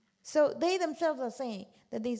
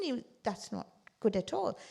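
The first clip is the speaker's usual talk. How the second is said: ordinary talk, nothing marked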